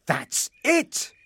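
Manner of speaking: high burst of inspiration; excited cry. A narrator's reading. Husband struck by a new wish, excited cry. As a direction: exclaim as the idea strikes. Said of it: "cried Frederick".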